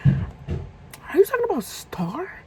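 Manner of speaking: slow, high-pitched disbelieving question; incredulous. Retold or imitated. Storyteller asks the caller about an old friend, high and incredulous. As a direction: drag it out, leaping up on the name. Realizes who the informant is.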